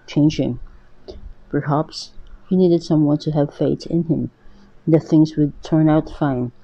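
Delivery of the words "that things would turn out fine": ordinary delivery, nothing marked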